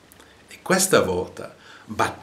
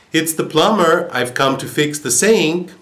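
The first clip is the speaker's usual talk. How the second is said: a little louder; 7 semitones higher, 6 dB louder than usual. A narrator's reading. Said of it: "answered a little more loudly this time".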